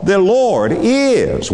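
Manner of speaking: "is" drawn out long, pitched high then falling; slow, high-pitched emphasis on "is"; stress on IS. Shown as a demonstration. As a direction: hold "is" and let it ring. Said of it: "put an emphasis on the word is".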